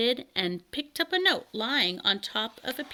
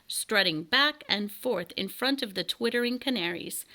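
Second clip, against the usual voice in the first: ordinary delivery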